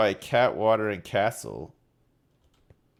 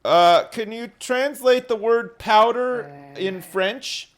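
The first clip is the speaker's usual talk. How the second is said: high, loud request to the device; calling out; 8 semitones higher, 6 dB louder than usual. Real, not acted. Man asking Alexa to translate powder, raised voice. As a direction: speak up so the speaker hears.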